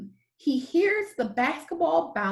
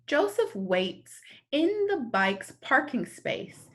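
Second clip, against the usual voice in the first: ordinary delivery, nothing marked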